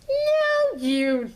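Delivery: high, loud yelped no; shocked no. Retold or imitated. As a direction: blurt out a shocked no. Retold outburst at classmate.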